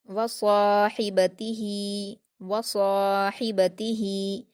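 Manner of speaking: वसाहिबतिही slowly, long heavy सा, throaty हि, long final ही; stretched, full sad. Shown as a demonstration. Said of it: "दो हरकत खींचकर".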